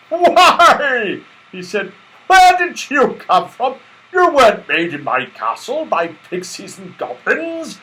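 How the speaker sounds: high, loud, booming Santa; jolly Santa Claus. Acted. Santa Claus admiring Silky, high, loud and jolly. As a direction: marvel at the lovely doll.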